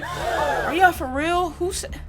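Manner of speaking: high, very slow, drawn out; incredulous. Real, not acted. Streamer asking chat, high and very slow. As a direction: stretch it in disbelief.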